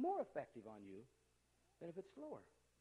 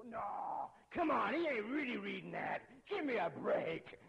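higher, louder mock disbelief, slowing at the end; incredulous scoff; 9 semitones higher, 9 dB louder, much slower than usual. Watching the speed-reading ad, loud scoffing disbelief. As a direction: scoff at the kid as if he's faking it. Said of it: "I go".

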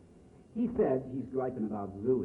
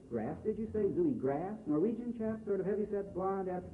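higher, quick, faux-puzzled put-on voice; mock innocent voice; 7 semitones higher than usual. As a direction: play dumb in a higher pitch. Zooey keeps up the pretence.

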